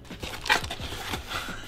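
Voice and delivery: long rire soufflé, presque sans voix; rire étouffé. Real, not acted. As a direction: laisse échapper un rire discret. Découvre le contenu du colis. (rire étouffé, soufflé)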